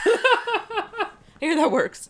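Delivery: laughing through the line. Real, not acted. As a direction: say it while cracking up. Laughing while repeating the joke.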